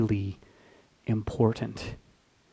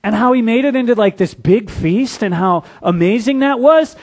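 eager reminding; 6 semitones higher, 4 dB louder than usual. Retold or imitated. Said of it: "they go".

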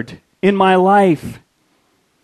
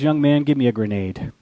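lower, softer steady request; calm colonel; 7 semitones lower, 6 dB quieter than usual. Retold or imitated. Colonel asks the lieutenant for a grenade, low and soft. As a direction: quietly take charge.

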